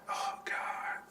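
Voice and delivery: breathless whispered dread. Retold or imitated. Told by the boss about a misspelling, whispered dread. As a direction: whisper it like you're caught.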